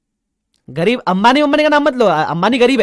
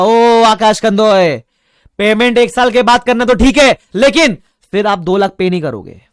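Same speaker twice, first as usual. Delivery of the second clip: owner demanding; 5 semitones higher, 10 dB louder than usual. Retold or imitated. Shop owner demanding money.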